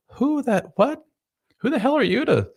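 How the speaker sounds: very high, mock female voice; scornful wife. Wife looks slob husband up and down, high mock-scornful voice. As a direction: squeal it as an incredulous wife. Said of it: "she'll look at you up and down and go".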